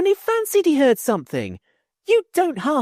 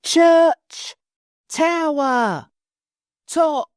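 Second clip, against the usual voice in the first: loud, slow, word by word; deepest voice slow and loud; 5 dB louder, much slower than usual. Acted. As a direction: boom each word down from the tower. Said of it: "in his deepest voice, very slow and loud".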